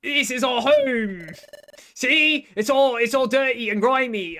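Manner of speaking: loud, hammed-up Australian accent that slips toward British; a cheerful, defiant mock Australian accent. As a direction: play the grimy Pokemon in a jokey Australian accent. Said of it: "I'm gonna do Australian".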